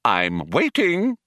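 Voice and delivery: slow, high, singsong prompting; stern matron. A narrator's reading. Computer cuts Zaphod off awaiting a confession, stern matron. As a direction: draw it out like a waiting schoolmistress. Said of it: "interrupted Eddie".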